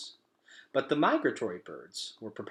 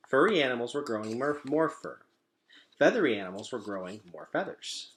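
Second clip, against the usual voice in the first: ordinary delivery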